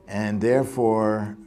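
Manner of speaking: ordinary delivery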